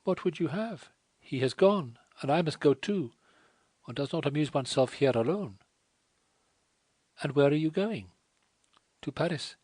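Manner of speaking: put-on a wretched girl begging her to leave; distressed French girl. A narrator's reading.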